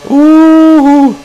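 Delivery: shrill high hoot, loud; high hooting cry. Real, not acted. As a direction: let out a loud high whoop. Exclamation during race.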